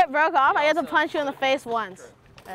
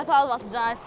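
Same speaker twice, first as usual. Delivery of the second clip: ordinary talk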